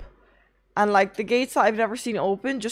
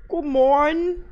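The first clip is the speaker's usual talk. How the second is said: higher, louder cheery announcer greeting; sing-song greeting; 6 semitones higher, 4 dB louder, slower than usual. Pretend. Streamer to chat, a big cheery sing-song greeting. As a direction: greet chat like a bright radio host.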